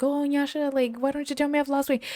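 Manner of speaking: high whiny mock plea; mocking needy coworker. Mocking the coworker who wants praise, high whine. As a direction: play the needy guy fishing for praise. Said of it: "I was like".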